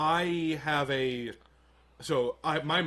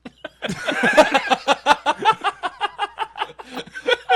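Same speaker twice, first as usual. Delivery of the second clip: loud high laughter; 13 semitones higher, 10 dB louder than usual. Real, not acted. Friends hear the Obama story, loud high laughter. Remembering the absurd wake-up.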